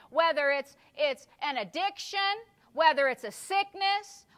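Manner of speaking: loud, high-pitched sermon climax; fired-up preaching. Real, not acted. Preacher urging congregation to slay the enemies, fired-up preaching.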